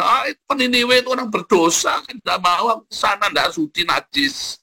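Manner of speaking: high-pitched, scornful outburst; haughty refusal. Acted. Proud Yunus refusing to go to sinners, haughty refusal. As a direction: act out a proud man refusing in disgust.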